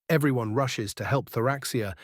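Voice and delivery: usual speaking voice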